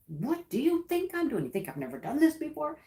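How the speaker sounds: high, quick mock-annoyed comeback; husband's irritated snap. Husband moving the table, hammed-up irritated retort. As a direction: imitate his huffy defensiveness. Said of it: "then he's like".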